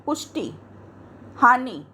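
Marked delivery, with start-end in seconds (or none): 0.1-0.5 पुष्टि with the final ि said quick and light; quick short i. Shown as a demonstration. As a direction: clip the ending of पुष्टि short. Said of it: "इसको जल्दी बोलना है". (पुष्टि छोटी इ जल्दी से)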